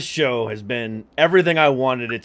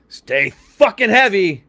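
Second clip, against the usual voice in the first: loud sign-off; 6 semitones higher, 4 dB louder, much slower than usual. Real, not acted. Show sign-off catchphrase.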